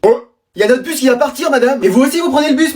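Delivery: ordinary delivery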